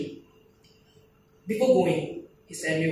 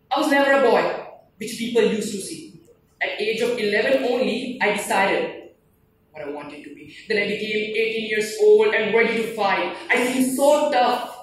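forceful, raised-pitch verse delivery; dramatic poem recitation; 3 semitones higher, 4 dB louder than usual. Acted. Soldier recalling his youth in a poem, declaimed. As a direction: declaim it proudly, loud on key lines.

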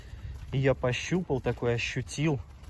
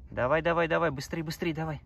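talking to a dog; 5 semitones higher than usual. Real, not acted. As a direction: cheer the dog on, faster. Urging dog to run faster.

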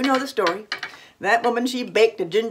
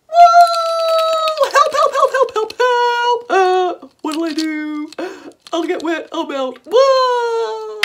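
panicked gingerbread man; 7 dB louder, slower than usual. Acted.

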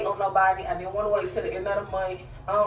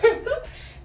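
high laugh. Real, not acted. Tired laugh at her breathlessness.

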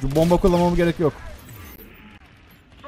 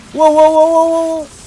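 high loud string of wows; excited wows; 10 semitones higher, 6 dB louder, slower than usual. Real, not acted. Gamer amazed by the action, high excited wows.

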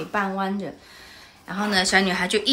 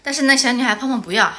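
ordinary talk, nothing marked

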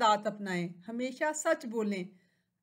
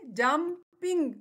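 Jumping slowly broken into two beats; split into syllables; 2 semitones higher, much slower than usual. Shown as a demonstration. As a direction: say jumping in two separate chunks. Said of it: "इसमें टू syllables हैं". (Jumping को दो हिस्सों में तोड़कर, धीरे)

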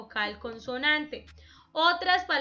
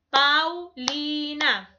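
"Paulina" drawn out slowly, one beat per syllable; teacher's slow syllable demo of "Paulina"; 4 dB louder, much slower than usual. Shown as a demonstration. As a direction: split Paulina into slow, separate voice beats. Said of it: "las sílabas es el golpecito de voz".

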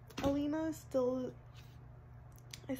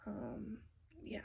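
ordinary delivery

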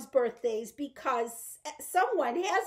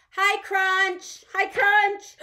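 loud, higher sing-out hello; too loud greeting; 7 semitones higher, 7 dB louder, much slower than usual. Pretend. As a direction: greet the shy dinosaur far too loudly. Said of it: "You said it too loudly".

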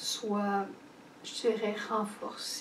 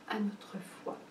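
whisper; 9 dB quieter than usual. Real, not acted. Whispered lead-in to adoration.

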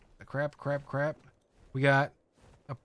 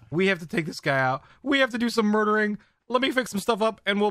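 hyped; 5 semitones higher, 4 dB louder than usual. Real, not acted. Hyped for boss fight.

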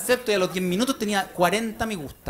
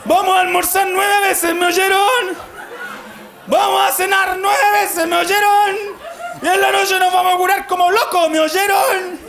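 father shouting to family; 9 semitones higher than usual. Retold or imitated. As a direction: whip the family up like a crazed dad. Father rallying family at hotel.

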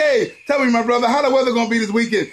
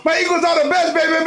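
ordinary talk

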